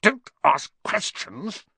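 high, slow, forceful snap; snapping. A narrator's reading. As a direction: shut down the question crossly. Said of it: "snapped his uncle".

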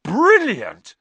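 put-on high, loud boyish cheer; thrilled exclamation. A narrator's reading. Fred and George hear of Knockturn Alley, thrilled. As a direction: burst out delighted. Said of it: "said Fred and George together".

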